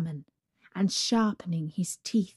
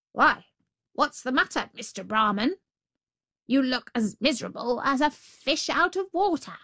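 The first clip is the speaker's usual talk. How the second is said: higher sly jackal voice; jackal calling out; 6 semitones higher than usual. Acted. The jackal hails the sad Brahmin, higher. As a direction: call out like a cheeky jackal.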